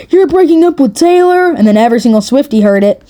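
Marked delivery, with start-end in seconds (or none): 0.1-1.5 mock scream. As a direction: yell it like the news can't be true. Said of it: "freaking screamed at the top of his lungs".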